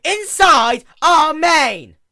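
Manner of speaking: very high, loud, slow wail; distraught sister wailing to Celestia; anguished wail. Acted. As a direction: stretch every word into a loud wail. Luna panics to her sister.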